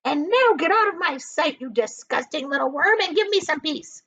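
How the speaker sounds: high, loud scolding hag; angry aunt's cry; Aunt Spiker scolding. A narrator's reading. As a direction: berate the lazy boy. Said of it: "she said".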